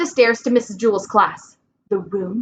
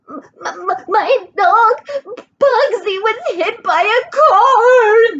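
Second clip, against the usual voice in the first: higher, louder stammering sob; crying girl; 11 semitones higher, 4 dB louder, slower than usual. Acted. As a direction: blurt it out through tears. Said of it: "Dana cried".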